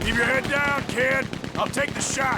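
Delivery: loud urgent shout; battle shout. Acted. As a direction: yell over gunfire. Shouting orders under fire.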